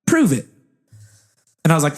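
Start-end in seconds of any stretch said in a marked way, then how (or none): 0.1-0.4 put-on higher-pitched, amateur New Zealand accent; bad New Zealand accent. Interviewer interrupts an applicant, bad New Zealand accent. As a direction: put on a shaky-quality Kiwi accent. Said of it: "do a very bad New Zealand accent".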